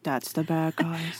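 a muted deadpan remark; quiet slow aside. Retold or imitated. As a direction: state the obvious, deadpan. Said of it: "I was gonna be like".

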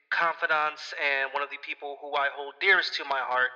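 usual speaking voice

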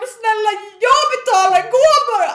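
very high, very loud outburst; even angrier. Retold or imitated. Argument over paying, loud high desperate shout. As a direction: imitate hysterical exasperated pleading at full volume. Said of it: "då blir hon ju ännu argare".

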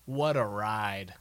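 normal talking voice

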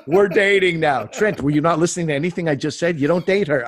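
playful outraged protest; mock exasperation. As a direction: scold a friend who did not listen. Mock exasperation at friend dating ex.

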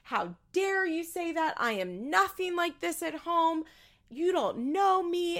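high-pitched mock indignation, drawn out; Amber losing it. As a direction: imitate someone outraged, stretching the words. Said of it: "Amber loses her mind".

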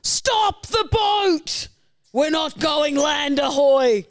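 put-on high, slow shouting old sailor; sea captain voice. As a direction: bellow like an old-timey captain. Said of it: "You're an old-timey sea captain".

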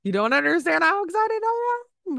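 high, gushing excitement; so excited. Real, not acted. Telling listeners season one is over, high excited gush. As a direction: squeal it with real delight. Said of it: "I'm so excited".

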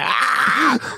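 a sudden high mock yelp; short high cry of pain, retold; imitated yelp of pain. Apprentice's finger cut off.

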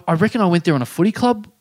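usual speaking voice

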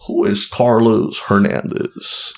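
imitated judge, slowly drawn out; slow, deliberate mock interrogation; slow pointed question. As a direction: draw out each word of the question.

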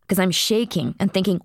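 ordinary delivery

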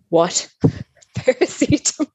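laughing, high-pitched mock disbelief; incredulous. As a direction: repeat it as a laughing, rising question. Retold disbelief at weak painkiller offer.

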